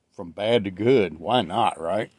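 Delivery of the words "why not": ordinary talk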